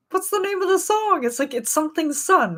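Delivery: very high-pitched, exasperated questioning; frustrated wail. Retold or imitated. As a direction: squeal it in mock desperation. Acting out frustration at forgotten title.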